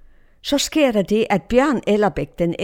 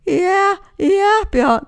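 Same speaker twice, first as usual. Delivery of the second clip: put-on high, small child's voice calling back; imitated little voice; 11 semitones higher, 4 dB louder, much slower than usual. Lost girl answers her rescuer in the woods, imitated high little voice. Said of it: "hører han en lille stemme".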